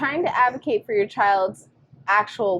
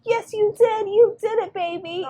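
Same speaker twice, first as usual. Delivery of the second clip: put-on high, sing-song praise for a toddler; mother gushing over her little boy; high, bright-eyed mom praise; praising mom; 13 semitones higher than usual. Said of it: "And I'm like".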